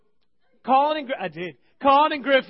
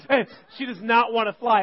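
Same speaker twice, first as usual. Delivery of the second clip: ordinary talk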